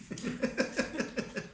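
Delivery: quick unvoiced laugh; a soft airy chuckle; breathy chuckle. Real, not acted. Preacher laughing at his own Betsabé joke, airy chuckle.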